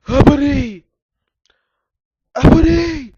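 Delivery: very loud, high screeched call; evil raspy villain. A narrator's reading. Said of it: "said in his evilly raspy voice".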